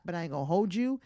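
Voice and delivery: ordinary delivery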